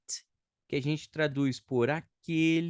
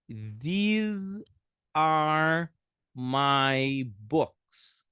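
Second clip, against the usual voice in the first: slow demo; 3 semitones higher, 5 dB louder, much slower than usual. Shown as a demonstration. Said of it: "A gente fala these".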